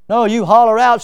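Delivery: ordinary talk, nothing marked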